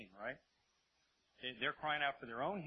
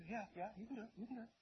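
high, soft, repeated yes; offhand assurance; 7 semitones higher, 6 dB quieter, faster than usual. Acted. Blind men assure Jesus he can, lightly voiced. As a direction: answer softly in a raised voice. Acts out blind men's answer.

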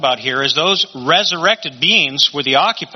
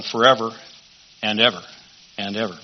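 "ever" repeated after pauses, each softer and lower; lingering repetition; 5 semitones lower, 4 dB quieter than usual. Real, not acted. Preacher warning of endless suffering, lingering repetition of "ever". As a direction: let each "and ever" sink away like an echo. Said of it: "kinda got off on that forever and ever".

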